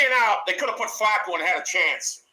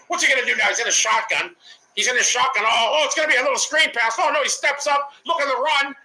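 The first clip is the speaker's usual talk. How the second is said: louder, building live commentary; excited play call; 4 semitones higher, 5 dB louder than usual. Real, not acted. Calling the Ravens play live, louder and excited.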